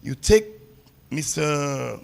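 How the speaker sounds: ordinary delivery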